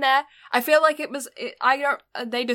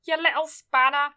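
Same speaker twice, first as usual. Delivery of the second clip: lightly voiced scolding mum; a hint of a telling-off mum; impression of mum; 4 semitones higher, 3 dB louder than usual. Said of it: "That's my impression of my mum".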